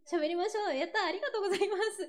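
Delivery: high, loud, happy burst; delighted cheer. Real, not acted. Singer celebrates the planned Kyushu-dialect after talk, delighted. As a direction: cheer and thank them, laughing at the end.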